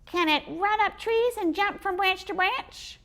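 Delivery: high squeaky puzzled squirrel. Acted. Squirrel baffled by the boy, squeaky.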